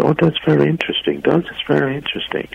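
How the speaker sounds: high, insincere "very interesting"; feigned interest. Retold or imitated. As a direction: politely cover up boredom as an American would. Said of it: "to znaczy, nudzisz waść".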